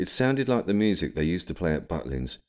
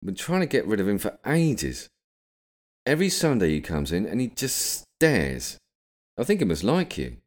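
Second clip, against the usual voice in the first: lightly voiced, higher barmaid; chatty barmaid; 5 semitones higher than usual. A narrator's reading. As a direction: confide in a customer in a higher voice. Barmaid chatting to William in story.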